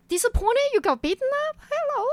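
high, incredulous mock retort; impatient. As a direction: throw the word back in disbelief. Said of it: "And I got impatient".

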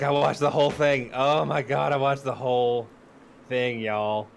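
ordinary talk

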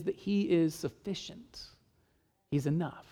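soft, slowed final words; hushed. Real, not acted. Preacher to congregation, quietly assured. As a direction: let it fall to near quiet.

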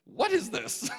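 high-pitched mock bafflement; astonished, voice jumping up; bewildered question. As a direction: ask it in acted disbelief. Acted bewilderment at shaking congregation.

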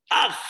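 brief imitated shriek; a sudden mock yelp; short startled "aj", mimicked; imitated wife's scream. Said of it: "Así dice".

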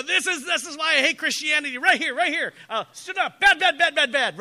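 loud, high, hammed-up outburst; rapid-fire mock tirade, words tumbling out; outraged rant. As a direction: rant loudly as if fleeing in disgust. Acts out his outraged younger self.

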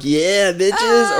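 mock hyped fan. Cohost imitates listeners who like the obnoxiousness, mock hyped. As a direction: play a fan loving the obnoxiousness.

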